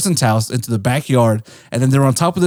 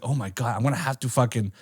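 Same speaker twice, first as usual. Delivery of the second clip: dismayed muttering; 2 semitones lower, 10 dB quieter than usual. Retold or imitated. Realizing he must face her family, soft dismayed muttering. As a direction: act out dread under the breath. Acting out dread to himself.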